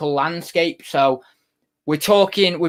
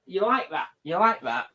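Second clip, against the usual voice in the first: ordinary delivery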